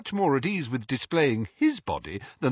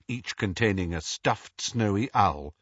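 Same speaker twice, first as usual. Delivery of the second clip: ordinary delivery, nothing marked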